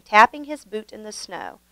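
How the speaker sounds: ordinary delivery, nothing marked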